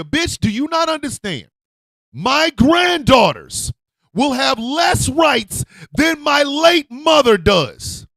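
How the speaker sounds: slow, high, loud shouting; furious shout. Real, not acted. Raging about her granddaughters' rights, slow furious shout. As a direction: pound each word in anger.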